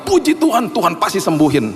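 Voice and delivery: a burst of high, joyful praise; excited praise. Retold or imitated. Preacher recalls praising God for a friend's healing, excited. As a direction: cry out thanks to God, full of hope. Retelling hope for friend's recovery.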